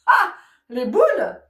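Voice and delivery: ah les boules, lent, fort et aigu; exagère la frustration; dépit joué. Retold or imitated. Joue la déception. (dépit théâtral, lent et fort)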